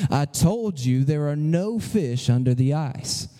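deep, slow, loud voice from above; mysterious voice. Acted. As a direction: boom it out low like a voice from heaven. Same mysterious voice, joke retold.

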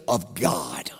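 whispered. Acted. Pastor drops to whisper.

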